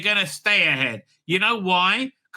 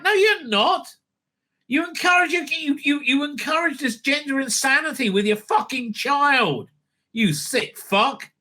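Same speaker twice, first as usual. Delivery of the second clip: angry retort; 8 semitones higher than usual. Real, not acted. Shouting back at the host on TV, angry. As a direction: hit back at the screen.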